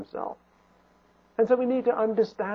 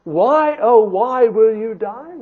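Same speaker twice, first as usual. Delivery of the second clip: God pleading with sinners; 2 semitones higher, 6 dB louder, slower than usual. Acted. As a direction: implore them, stretching the words out. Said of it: "God says".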